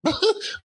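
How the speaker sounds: squeaky delighted laugh; old man's high gleeful cackle; high giddy cackle. Acted. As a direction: cackle with glee. Scrooge laughs.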